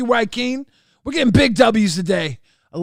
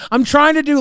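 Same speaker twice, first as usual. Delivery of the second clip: ordinary talk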